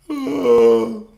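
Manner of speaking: high, loud mock panicked yelp; hammed-up panic squeal; mock breakdown. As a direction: play a sudden panicked cry. Acting out a nervous breakdown.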